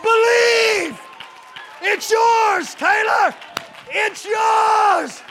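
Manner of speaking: high, loud shouting with long-held final words; full-force preaching. Real, not acted. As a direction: holler it to the whole congregation at the sermon's climax. Preacher at climax of sermon.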